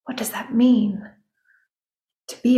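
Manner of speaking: whispered question to herself. Real, not acted. Host pondering the word aloud in a whisper.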